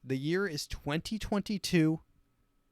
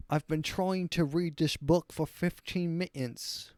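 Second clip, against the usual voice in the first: higher-pitched dopey mock-Brit; dim British accent; 4 semitones higher than usual. Host imagining a Brit struggling to read, dim British accent. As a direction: play a slow-witted British character. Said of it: "mentally challenged British person".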